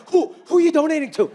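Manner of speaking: high, sharp mom voice; a mother catching him out; suspicious mom. Retold or imitated. Voicing his mom.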